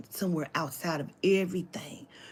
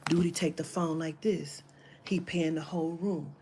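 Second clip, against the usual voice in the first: ordinary delivery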